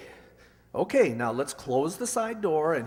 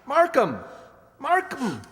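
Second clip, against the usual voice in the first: calling out; 7 semitones higher than usual. Acted. Calling to the pinned man.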